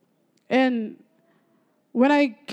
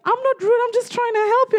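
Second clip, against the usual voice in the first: high-pitched, hammed-up defensiveness; mock indignant protest; 11 semitones higher than usual. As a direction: plead innocence high up in the voice. Daughter protests to angry mother.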